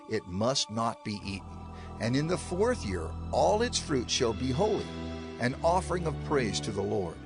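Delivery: ordinary talk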